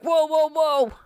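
high startled whoa, whoa, whoa; mock spooked yelps. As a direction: jump back, spooked. Crew startled by thrown rock.

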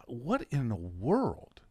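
slow, drawn-out disbelief with swooping pitch; imitated bewilderment. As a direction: voice a baffled reaction, stretching the words. Voicing millennials' reaction to church.

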